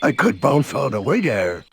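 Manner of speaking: bouncy cartoon tiger voice; lightly voiced bouncy tiger; Tigger voice. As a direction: play Tigger, cocky and breezy.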